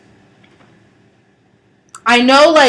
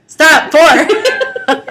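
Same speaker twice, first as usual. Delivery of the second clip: laughing; 4 semitones higher, 5 dB louder than usual. Real, not acted. Laughing at partner.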